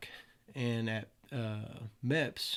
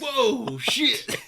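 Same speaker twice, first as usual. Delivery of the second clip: high, loud startled yelp breaking into laughter; 13 semitones higher, 11 dB louder than usual. Retold or imitated. Recruit startled by the sudden yell, high laughing yelp. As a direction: jump back in shock, then laugh. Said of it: "it fucking freaked me out".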